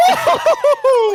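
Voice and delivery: high, slow laughing whoop; cheering whoop. Real, not acted. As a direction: whoop with joy. Cheering the goal.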